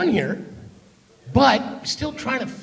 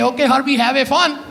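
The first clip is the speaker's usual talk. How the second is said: higher imitation of mom's Punjabi-accented catchphrase; friends copying mom; 3 semitones higher, 3 dB louder than usual. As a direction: copy a Punjabi mom's sign-off for laughs.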